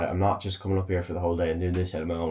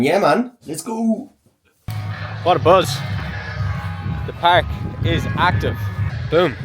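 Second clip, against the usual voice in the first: excited; 7 semitones higher than usual. Real, not acted. Arriving at the busy park.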